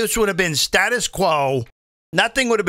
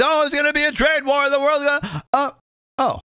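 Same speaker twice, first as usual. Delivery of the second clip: higher whiny mock alarm; mock panic; 7 semitones higher than usual. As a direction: parody the doom-sayers again. Mocking critics' panic.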